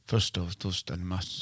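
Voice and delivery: put-on low, hushed old troll voice; hoarse old troll. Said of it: "en ganska hes, gammal man".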